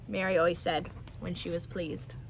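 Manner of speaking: ordinary delivery, nothing marked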